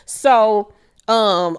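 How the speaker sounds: ordinary talk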